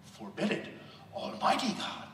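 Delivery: ordinary talk, nothing marked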